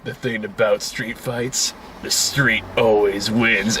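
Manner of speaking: low, slow gruff action-hero voice; Vin Diesel. Retold or imitated. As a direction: growl it out slow and low like a tough guy. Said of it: "And then Vin Diesel says".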